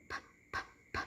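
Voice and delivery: "p" as soft bare puffs; unvoiced P. Shown as a demonstration. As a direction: pop "p" using only air, no voice. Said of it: "look at the same sound".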